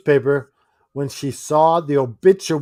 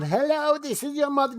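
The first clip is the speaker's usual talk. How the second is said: much higher, put-on impostor mother; fake mother voice; 13 semitones higher than usual. Host playing an impostor calling as the mother, high pitched. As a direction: squeak it up like someone faking mum. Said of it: "you can't fool someone".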